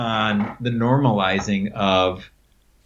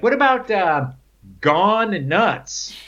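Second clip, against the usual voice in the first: playful pitch; 9 semitones higher, much slower than usual. Retold or imitated. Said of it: "We're joking around".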